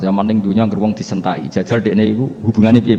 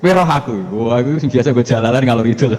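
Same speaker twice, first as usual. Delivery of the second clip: swaggering boast; 3 semitones higher, 4 dB louder than usual. Acted. Umar bragging to Munkar Nakir, loud swagger. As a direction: puff up like a street tough.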